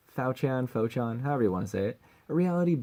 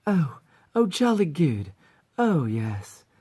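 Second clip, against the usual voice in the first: put-on high, raised moaning in a British accent; light moaning; 6 semitones higher, 6 dB louder, slower than usual. Succubus mascot's line four, light British-accented moans. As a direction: moan the words lightly, very British. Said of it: "light sexual moans".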